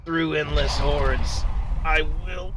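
slow, drawn-out hero declaration; mock-heroic vow. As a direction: declare it slowly like a hammed-up hero. Mock-heroic vow mid-game.

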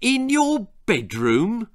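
father stunned at the bedroom volcano, disbelieving; startled. Acted. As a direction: still taken aback. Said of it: "said his startled father".

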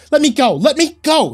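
high, loud frantic cries; frantic shouting. A narrator's reading. Wife slapping at husband's hands, frantic shouting. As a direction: shout as if fighting free. Wife fights being carried off.

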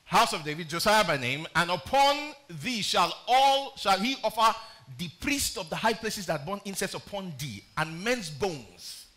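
booming scripture proclamation; scripture reading. Acted. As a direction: declaim the verse to the church. Preacher reading scripture aloud.

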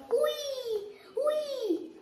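high, happy squeals of wee; gleeful wee. Real, not acted. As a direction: squeal with joy on the ride. Going down the ride.

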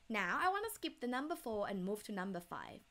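usual speaking voice

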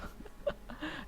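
airy high-pitched giggle; a quick breathless giggle at the hungover hero; high breathy laugh. Real, not acted. Gamer laughing at his drunk cowboy, airy giggle.

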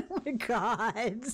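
laughing. Real, not acted. Laughs at game fight.

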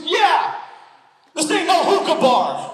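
high, loud hype-up cry; imitated wife's outburst. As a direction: shout it proudly like a hype woman. Imitates wife grabbing the mic.

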